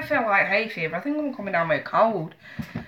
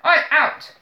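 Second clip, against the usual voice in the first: calling out; 6 semitones higher, 4 dB louder than usual. Real, not acted. Telling child to leave.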